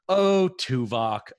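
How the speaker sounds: slow, loud, drawn-out catchphrase; catchphrase reaction. Shown as a demonstration. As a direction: stretch it out like a fond groan at a killjoy. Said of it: "I'm gonna call it the".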